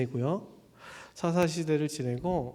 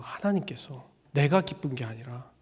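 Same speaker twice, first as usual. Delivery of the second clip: ordinary talk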